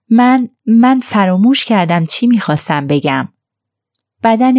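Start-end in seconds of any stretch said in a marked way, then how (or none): none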